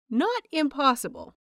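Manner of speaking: ordinary delivery, nothing marked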